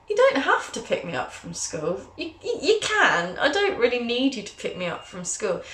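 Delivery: rising bewildered reply; teen self baffled by mum's offer; confused teen. Retold or imitated. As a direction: stammer it out puzzled. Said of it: "And I'm like".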